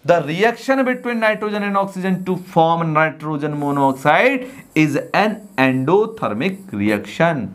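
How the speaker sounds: very slow, word-by-word dictation of a definition. Shown as a demonstration. Teacher dictating a definition to students, very slowly. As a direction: read it out slowly so the class can write it down.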